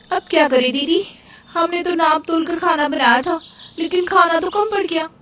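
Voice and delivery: put-on high-pitched, anxious young wife; worried dismay. Younger wife asks her sister-in-law about the food shortage, worried. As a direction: fret in a high voice, at a loss. हैरानी से एक दूसरे को देखने.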